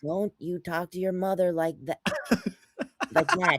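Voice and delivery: imitated scold breaking up into a squeal; mock scolding. Retelling a mother-son TV scene, high mock scolding. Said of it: "And then he's like".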